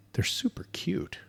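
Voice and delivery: whispered aside, no voice; hushed whisper. Real, not acted. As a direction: whisper it under your breath. Stressing cuteness, whispered take.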